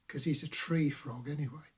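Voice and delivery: ordinary delivery, nothing marked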